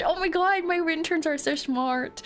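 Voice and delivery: high, bright delighted gushing; flattered excited squeal. Real, not acted. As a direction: gush over the compliment. Flattered by chat compliment.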